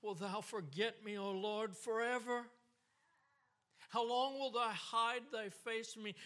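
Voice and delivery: high, loud cry of the psalm; David's lament, lifted high and loud; anguished psalm reading. Acted. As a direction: cry it out to God, pleading. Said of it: "Listen to what David says".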